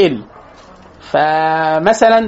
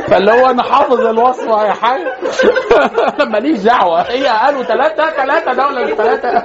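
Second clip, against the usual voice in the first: talking through real laughter; laughing; 5 semitones higher than usual. Retold or imitated. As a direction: keep chuckling as you tell it. Laughing through his own anecdote. (بيتكلم وهو بيضحك)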